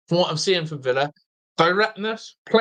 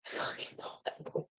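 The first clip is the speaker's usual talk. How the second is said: breathy whispered curse under the breath; muttered whisper, no voice; whispered swear; 18 dB quieter, much slower than usual. Real, not acted. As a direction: hiss it to yourself in shock. Swearing at match moment.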